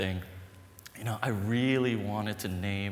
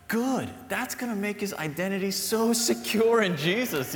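high, loud, eager self-talk; pumped-up imitation of his own hopeful thought; excited inner voice; 9 semitones higher, 5 dB louder than usual. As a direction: cheer yourself on with a big lift. Imagines his own hopeful reply.

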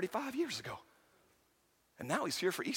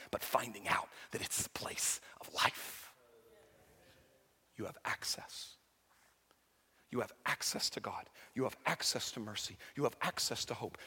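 intimate hushed preaching; 7 semitones lower, 4 dB quieter than usual. Acted. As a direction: close gently, almost whispering. Intimate closing sermon.

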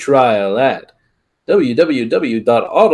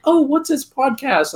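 high mock youth; lightly voiced curious kid; curious teen voice; 13 semitones higher than usual. Youth voiced in retold story.

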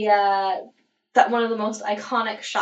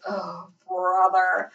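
unimpressed Scully; much slower than usual. Retold or imitated. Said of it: "Scully's reaction of, like".

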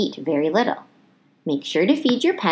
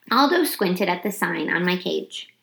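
plain reading voice

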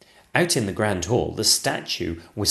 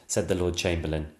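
ordinary delivery, nothing marked

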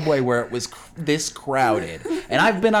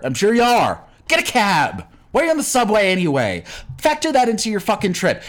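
higher-pitched, exasperated scolding; angry rant; 4 semitones higher than usual. Acted. Subway rider telling runners to get a cab, exasperated. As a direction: lay into them with emphatic, impatient orders. Ranting advice at imagined marathon runners.

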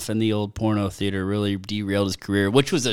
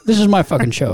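mock diva claim; 6 semitones higher, 4 dB louder than usual. Mocking brother's claim.

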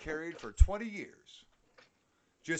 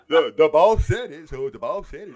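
louder mock dumb voice; mocking coworkers; 4 semitones higher, 16 dB louder than usual. Mocking coworkers who parrot the boss, loud and dopey. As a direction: ham up the boss worship.

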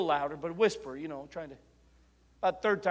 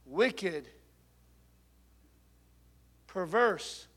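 prayer; 4 semitones higher, 9 dB louder, much slower than usual. Acted. Slow prayer.

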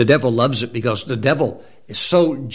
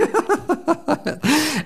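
high-pitched delighted laugh; high giggle. Real, not acted. Preacher laughing at his own childhood story, high giggle. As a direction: let out a squeaky laugh.

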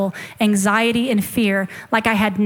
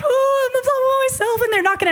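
mock crying; 13 semitones higher than usual. Retelling her tearful call to the pastor, mock high-pitched wailing. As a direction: wail it like an exaggerated sobbing phone call. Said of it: "I was crying on the phone".

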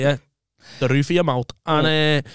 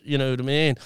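normal talking voice

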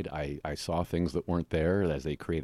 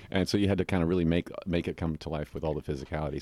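ordinary delivery

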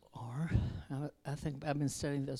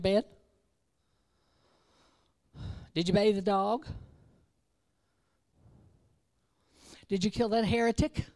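mommy quizzing; 4 semitones higher, 6 dB louder than usual. Acted. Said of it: "And Mommy said".

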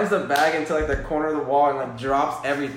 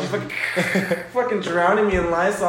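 ordinary talk

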